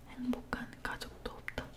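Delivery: ordinary delivery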